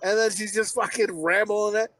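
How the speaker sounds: normal talking voice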